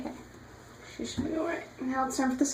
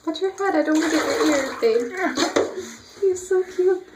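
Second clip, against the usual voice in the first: higher sweet coo to the dog; 6 semitones higher than usual. Real, not acted. Cooing at dog in bath.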